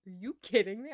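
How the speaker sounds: loud, disbelieving outburst; retold incredulity. He sees salt on the bottle, retold loud incredulity. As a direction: imitate him in loud disbelief. Retold astonished reply in argument.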